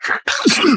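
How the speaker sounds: sudden loud sneeze. Real, not acted. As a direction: sneeze into the mic. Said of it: "I sneezed into the microphone".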